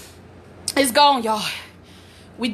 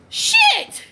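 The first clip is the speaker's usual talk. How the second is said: very high, sudden cry; exasperated yell. Retold or imitated. Mother fed up with her unhelpful daughters, exasperated yell. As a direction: squeal the curse in exasperation.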